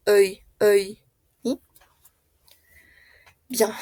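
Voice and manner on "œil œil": œil said with a rounded closed vowel, not an open O; œil with a rounded front vowel, not an open O; French œil sound. Shown as a demonstration. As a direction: model œil carefully, not like oi. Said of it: "pero no con una O abierta, sino como un œil".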